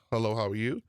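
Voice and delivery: ordinary talk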